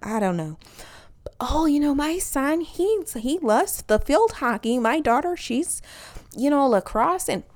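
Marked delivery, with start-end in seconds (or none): 1.4-7.3 slightly high Canadian-tinged mom chatter. Retold or imitated.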